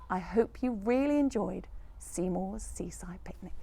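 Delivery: soft breathy sign-off; whispered goodbye. Real, not acted.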